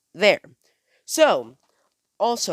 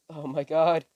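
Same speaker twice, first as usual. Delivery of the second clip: scared. Retold or imitated. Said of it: "that scared face".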